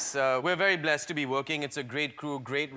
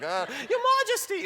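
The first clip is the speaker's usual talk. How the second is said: very high, mock British exclamation; hammed-up British accent, pitched way up; silly British accent; 4 dB louder than usual. Nervous actor retelling his greeting to the Queen, silly British accent. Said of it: "in a British accent".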